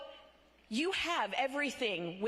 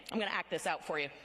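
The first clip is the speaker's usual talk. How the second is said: usual speaking voice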